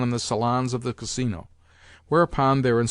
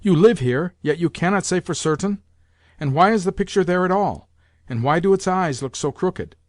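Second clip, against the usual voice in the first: put-on higher, demanding old lady voice; imperious grandmother; 6 semitones higher, 4 dB louder than usual. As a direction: scold the landlord with pointed questions. Grandmother questions the landlord.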